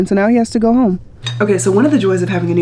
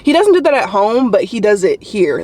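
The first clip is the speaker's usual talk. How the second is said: usual speaking voice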